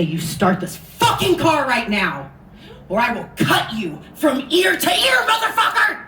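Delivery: building threat ending in a high laughing yell; mock fierce threat. Hitchhiker threatening the driver at knifepoint, a building mock fierce yell.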